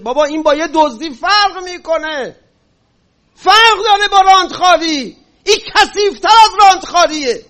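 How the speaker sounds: high, forceful shout of outrage; real shouting. Real, not acted. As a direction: shout it out, loud and high, as a rebuke to the crowd. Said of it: "چرا خودت فریاد زدی".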